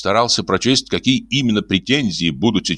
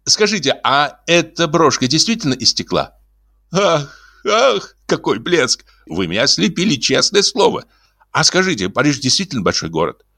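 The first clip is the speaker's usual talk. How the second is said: high, teasing, over-the-top compliments; mocking gush; 9 semitones higher than usual. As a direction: needle her with breathless praise. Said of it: "вызывающе острить". (высоко, с издевательским восторгом)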